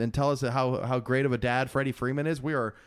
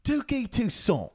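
Irish-accented name, higher and slow; Irish accent attempt; 8 semitones higher, slower than usual. Shown as a demonstration. Host trying an Irish accent on request, higher and slow. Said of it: "give me, give me, uh, a Tucson".

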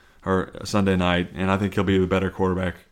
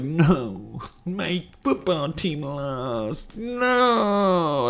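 high, slow, soft mopey whine; mock sad whine; 8 semitones higher, 4 dB quieter, much slower than usual. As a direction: whine it like a sulking fan. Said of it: "I was like".

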